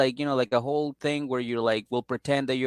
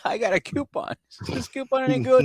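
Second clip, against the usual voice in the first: mock customer; 11 semitones higher than usual.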